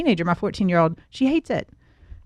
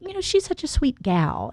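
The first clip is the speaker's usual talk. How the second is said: higher, softer put-on elder gentleman; imitated older men; 6 semitones higher, 6 dB quieter than usual. Older finance men praising a young woman, imitated in a high, soft put-on voice. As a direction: mimic a patronizing older colleague, pitched up and soft. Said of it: "stately elder men that are in that industry".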